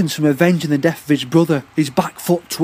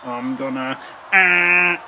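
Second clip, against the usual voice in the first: shaking with rage; much slower than usual. Acted. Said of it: "shaking with rage".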